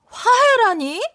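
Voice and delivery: high and loud, scandalized; indignant surprise. Acted. Wife protests to her husband, high indignant surprise. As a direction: pretend outrage at the word.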